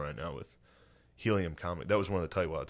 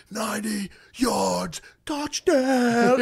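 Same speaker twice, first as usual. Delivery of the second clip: drawn-out, high-pitched excited yell; slow, soaring mock celebration scream; Philip Rivers spazzing; 6 dB louder, much slower than usual. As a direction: imitate a kid-like touchdown holler, stretching the words. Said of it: "Philip Rivers stands up. He's like".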